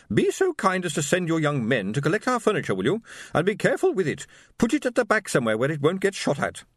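high, haughty matron summoning her husband; imperious wife. A narrator's reading. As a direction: sharply call your husband to order. Wife voiced in story.

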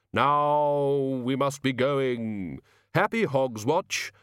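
very slow, drawn-out farewell; solemn Death. Acted. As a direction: stretch the words out ceremoniously. Death takes his solemn leave.

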